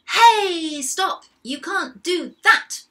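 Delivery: loud, high, drawn-out scolding; storybook angry salesperson shouting. A narrator's reading. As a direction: yell at them to stop. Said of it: "shouted a very angry salesperson".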